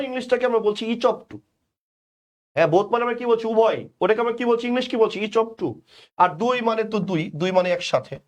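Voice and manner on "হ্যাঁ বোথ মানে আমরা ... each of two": ordinary talk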